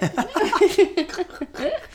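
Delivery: high giggling laugh; bursting into laughter. Real, not acted. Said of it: "I'm here for it".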